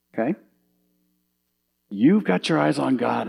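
ordinary talk, nothing marked

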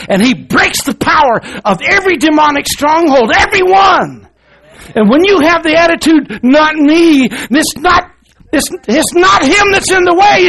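loud, high revival preaching, near shouting; preaching at full force. Acted. Preacher rousing his church to faith, shouting it out. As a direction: drive it home to the congregation.

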